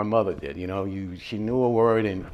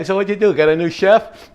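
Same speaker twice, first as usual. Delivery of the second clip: loud, high-pitched mock question; raised voice, playing the nosy customer; challenging customer; 9 semitones higher, 10 dB louder than usual. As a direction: call it out like a needling diner. Imagined customer questioning the restaurant.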